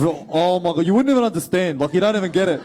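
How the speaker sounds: loud, exasperated Punchbowl accent. Shown as a demonstration. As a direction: scoff at outsiders in a Punchbowl accent. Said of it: "There's a very specific Punch Bowl accent".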